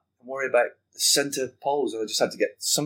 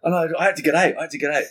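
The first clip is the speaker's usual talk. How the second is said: bursting excitement; 5 semitones higher, 4 dB louder, faster than usual. Real, not acted. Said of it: "I was detonated inside".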